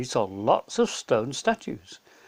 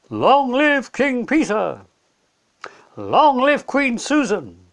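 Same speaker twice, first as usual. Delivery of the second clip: much higher, louder slow cheering calls; crowd cheering; 8 semitones higher, 8 dB louder than usual. Acted. Narnians hail their new kings and queens, cheering loud. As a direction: call it out like a crowd at a coronation. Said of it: "the people cried out".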